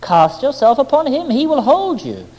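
half persuading, half mocking. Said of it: "she smiled at me, half persuading, half mocking".

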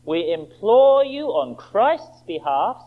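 slow, solemn reading of the verse; measured, reverent scripture read. Acted. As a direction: read it slowly like holy writ.

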